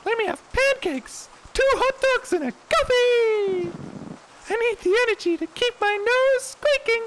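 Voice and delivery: very high, loud squeaky clown. Acted. Clown customer ordering pancakes, squeaky high voice. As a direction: bellow it like a cartoon clown.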